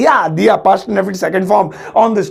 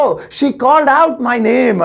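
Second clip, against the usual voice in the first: slow careful English; 3 semitones higher, much slower than usual. Shown as a demonstration. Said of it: "तो उसने मेरा नाम जोर से पुकारा".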